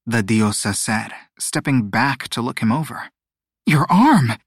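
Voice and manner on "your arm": high, loud alarmed gasp. A narrator's reading. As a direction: blurt it out in alarm. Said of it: "she gasped".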